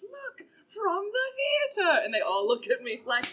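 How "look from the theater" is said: high, slow proud host voice; excited restaurant owner. Retold or imitated. Owner presenting her to his friend at the bar, high slow mock voice. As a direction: show her off like a trophy. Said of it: "he goes".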